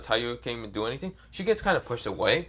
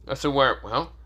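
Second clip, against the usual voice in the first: ordinary delivery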